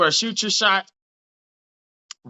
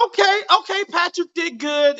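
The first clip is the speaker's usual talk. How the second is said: excited; 10 semitones higher than usual. Real, not acted. Host excited after call.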